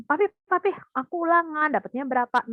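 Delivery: put-on eager little kid to father; excited child. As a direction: call out like a child bursting with news. Child calls father about score.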